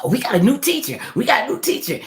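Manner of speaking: high, loud excited chatter; excited kids. Retold or imitated. Schoolkids announcing a new teacher, high and loud excitement. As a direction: squeal the news like thrilled schoolkids. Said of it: "And they said".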